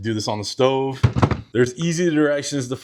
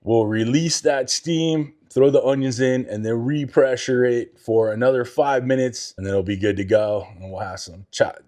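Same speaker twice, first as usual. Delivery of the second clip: normal talking voice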